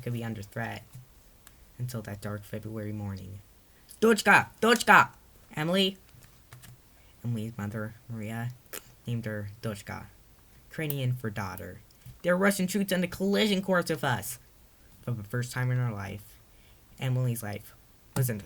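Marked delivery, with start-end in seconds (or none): 4.0-5.1 high, loud urgent call. Acted. Mother calling out to daughter. 5.5-6.0 high, louder questioning call. Acted. Calling the daughter's name. 12.2-14.4 higher, louder urgent news; alarmed warning. Acted. Mother warns of troops.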